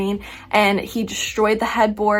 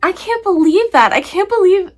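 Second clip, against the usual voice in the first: much higher and louder, I can't believe it; incredulous outburst; 10 semitones higher, 7 dB louder than usual. Real, not acted. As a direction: blurt out disbelief, pitched way up. Outburst at the book's lack.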